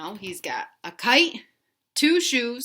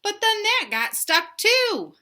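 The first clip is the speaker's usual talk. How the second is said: slow, higher and louder storybook build; reading to children; 12 semitones higher, 6 dB louder, slower than usual. A narrator's reading. Teacher reading Stuck to young kids, big storybook voice. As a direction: lift the line up high to make it dramatic. Teacher reads picture book aloud.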